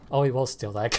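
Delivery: usual speaking voice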